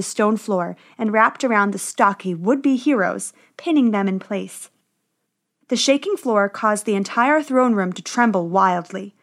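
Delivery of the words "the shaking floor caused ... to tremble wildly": ordinary delivery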